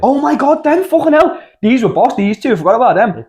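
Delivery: high, loud burst of delight; light up as the memory hits; excited remembering. Real, not acted. Remembering the two Irish lads to his mate, excited yelp.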